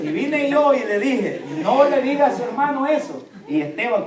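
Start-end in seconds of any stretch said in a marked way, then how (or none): 1.6-3.3 raised, louder retold reprimand; mock scolding. Father correcting his son for teasing, mock scolding. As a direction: act out a dad telling a child off.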